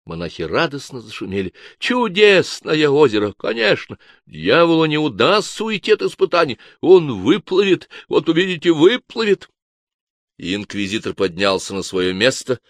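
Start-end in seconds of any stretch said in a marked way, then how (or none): 1.8-9.5 high, loud fanatical cheer; eager zealot. A narrator's reading. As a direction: gloat over the devil's trial. Monks chime in eagerly.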